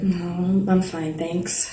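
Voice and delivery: quiet denial. Acted. Quietly brushing off comfort.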